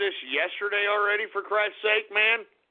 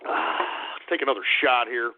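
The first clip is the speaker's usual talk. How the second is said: ordinary talk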